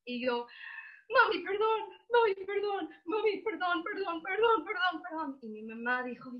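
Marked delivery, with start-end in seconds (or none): 1.1-5.4 high, tearful begging; imitated child sobbing out sorry on repeat; crying apology. Crying child begging her mother's forgiveness as she is hugged, sobbing.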